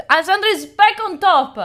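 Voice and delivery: loud, thrilled call of the lead change; excited announcement. Real, not acted. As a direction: shout the news out happily. Leaderboard lead changes back.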